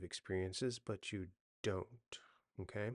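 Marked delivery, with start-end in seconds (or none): none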